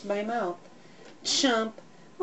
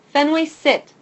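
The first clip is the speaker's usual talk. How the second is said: louder command to the dog; firm command; 6 semitones higher, 8 dB louder than usual. A narrator's reading. Girl ordering her excited puppy, firm. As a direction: tell the dog to sit firmly. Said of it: "she says".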